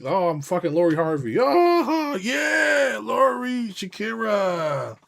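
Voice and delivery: squealing high and loud like a starstruck fan; mock-fangirl squeal, rising to a near scream; gushing fangirl. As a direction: shriek the names like you've spotted celebrities. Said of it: "you just be".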